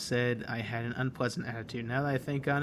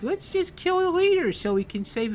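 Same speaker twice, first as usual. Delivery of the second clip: mocking the villain; 7 semitones higher than usual. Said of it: "it's like".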